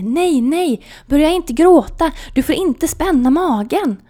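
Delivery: high, loud urgent warning; alarmed nurse. Retold or imitated. Nurse warning crying patient, urgent loud alarm. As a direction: stop her before she cries.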